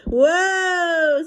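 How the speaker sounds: high startled whoa from the dad. Acted. Father reacting to the dog's smell, startled. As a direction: jump up in pitch with a startled cry. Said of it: "said Amelia Dill's father".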